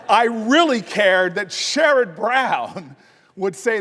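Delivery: laughing through the words; slow, laughing amusement. Real, not acted. Laughing at his own joke.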